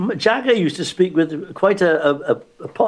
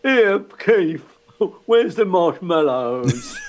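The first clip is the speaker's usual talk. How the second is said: exaggerated rock-star voice, words stretched out; hammed-up, drawn-out rock singer impression; 2 semitones higher, much slower than usual. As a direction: drawl it slowly like a comic impressionist. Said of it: "doing an impression of him".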